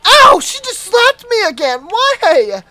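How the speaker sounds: high, loud, outraged complaint; indignant wail. Real, not acted. Player slapped by the nurse again, high indignant wail. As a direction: wail in protest at being hit.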